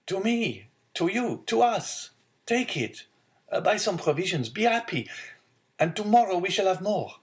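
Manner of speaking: a hint of jubilant youth; joyful son. Son joyfully handing over money.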